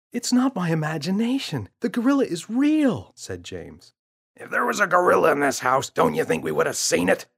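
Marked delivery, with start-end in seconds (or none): 0.1-3.1 put-on a young boy, much higher and louder, insisting; boy's voice. Boy protests to parents. 4.4-7.3 a scoffing father, higher and louder, fast; father's voice. Acted.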